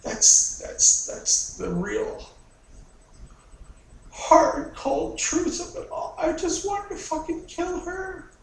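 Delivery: loud, high, raw anger spilling out; shouting out years of hatred; furious outburst. Acted. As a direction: rage at the mother who never cared. Raging confession about mother.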